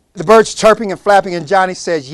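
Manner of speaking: normal talking voice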